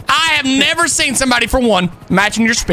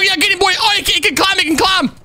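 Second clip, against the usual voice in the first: usual speaking voice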